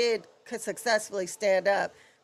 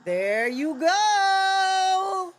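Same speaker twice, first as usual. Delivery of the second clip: drawn-out cheer; 9 semitones higher, 4 dB louder, much slower than usual. Real, not acted.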